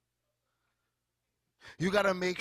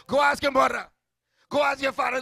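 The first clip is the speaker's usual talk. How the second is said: loud preaching; 7 semitones higher, 10 dB louder than usual. Acted. Preacher repeats parental line loudly.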